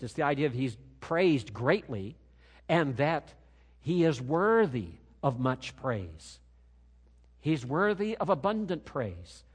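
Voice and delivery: ordinary talk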